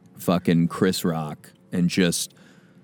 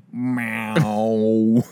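cat meow sound. Retold or imitated. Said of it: "one went".

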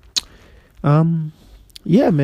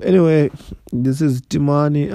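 ordinary talk

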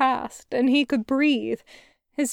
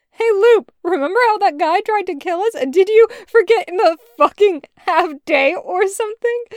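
lightly voiced boy, higher and louder; teasing boy voice; 6 semitones higher, 7 dB louder than usual. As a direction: tease your sister, voice pitched up. Boy character teasing his sister.

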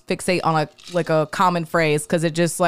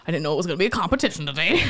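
fast mock retort; 3 dB quieter, faster than usual. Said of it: "You're just like".

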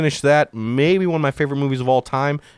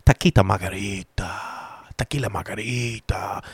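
lightly hammed-up Spanish accent on the drink name; playful fake Spanish accent; put-on Spanish accent; 2 semitones lower, 3 dB quieter than usual. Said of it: "Is that an accent?".

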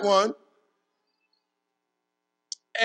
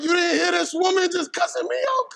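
indignant protest; 9 semitones higher than usual. Retold or imitated. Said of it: "And I'm like".

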